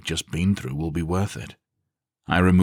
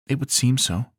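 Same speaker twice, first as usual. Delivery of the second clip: higher woman's voice, pleased; 8 semitones higher than usual. A narrator's reading. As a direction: answer with a hint of a smile. Zarina smiles.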